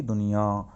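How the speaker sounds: nasal ending on "दुनिया", held long; नाक से आवाज़. Shown as a demonstration. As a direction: let the final vowel of "दुनिया" hum through the nose. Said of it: "नाक से आवाज़ करते हैं". ("दुनिया" का आखिरी आ अनुनासिक)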